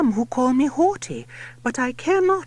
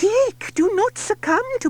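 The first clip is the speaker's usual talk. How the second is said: higher, louder call; cat shouting; 10 semitones higher, 5 dB louder than usual. A narrator's reading. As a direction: call out urgently. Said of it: "The cat shouts".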